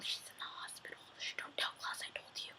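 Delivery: hushed conspiratorial whisper; barely audible, voiceless secret; secretive whisper. Retold or imitated. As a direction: whisper it like a forbidden tip-off. Secretly tipping off Damon.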